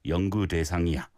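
Korean phrase in an even, connected pattern; flowing rhythm. Shown as a demonstration. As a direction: keep the low-pitched phrase flowing. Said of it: "the rhythm and the pattern of the phrase".

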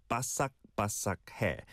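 바삭바삭해 with a crisp k stop in each 삭; final k sounds clear. Shown as a demonstration. Teacher shows a listener the k in 바삭바삭해, clear and careful. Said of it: "There's a 기역 받침 bottom consonant".

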